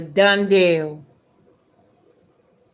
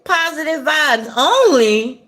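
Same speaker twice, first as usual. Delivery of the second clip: higher, incredulous echo question; throwing shade; 6 semitones higher, 3 dB louder than usual. Real, not acted. Shading a positive-vibes comment, mockingly incredulous. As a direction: toss the phrase back in disbelief. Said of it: "I'm gonna throw some shade".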